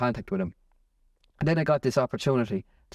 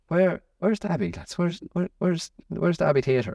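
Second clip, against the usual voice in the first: retold lost asking; 6 semitones higher than usual. Retold lost asking passers-by for directions.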